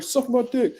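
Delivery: high, squeaky taunting voice; mock fighter taunt. Fighter mocked while quoting his trash talk, high squeak. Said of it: "Jerry Miller was like".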